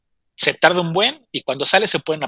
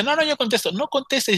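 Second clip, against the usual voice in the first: high-pitched mock squabble; insistent bickering; 10 semitones higher than usual. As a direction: argue back and forth, pitch jumping up. Quarrel over answering the phone.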